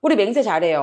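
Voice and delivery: usual speaking voice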